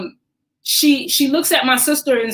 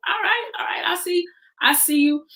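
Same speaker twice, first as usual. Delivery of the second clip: sassy clap back; 7 semitones higher, 4 dB quieter, faster than usual. Retold or imitated. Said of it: "And then I clap right back".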